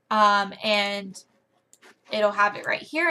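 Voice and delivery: normal talking voice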